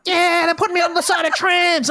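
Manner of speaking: very high, frustrated comic rant; Dave Hughes. Retold or imitated. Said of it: "his voice is the funniest voice".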